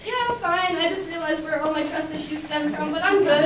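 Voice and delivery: high, loud, laughing through the words; giggly forced cheer. Retold or imitated. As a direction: brush it off with a laugh. Laughing off crying.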